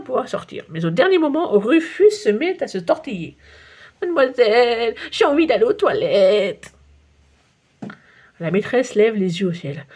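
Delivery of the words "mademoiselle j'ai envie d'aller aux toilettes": put-on whiny child. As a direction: beg the teacher to go to the toilet. Rufus demande à la maîtresse. (petite voix d'enfant qui supplie, lente)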